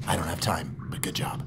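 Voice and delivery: dying whisper. Pretend. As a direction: breathe it out faintly. Dying soldier's last whisper.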